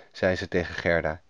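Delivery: ordinary delivery, nothing marked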